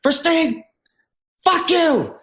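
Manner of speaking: high, loud imitated swearing at a player; angry coach. Coach swearing at Versteeg during video review, high loud mock yell. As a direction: snap it like a furious coach in video session. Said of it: "He's like".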